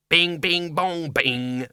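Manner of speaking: sing-song clock demon; demon chime. A narrator's reading. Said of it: "said the demon".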